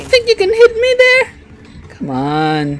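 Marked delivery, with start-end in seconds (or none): 0.0-1.2 taunting. Real, not acted. Taunting enemy in gunfight.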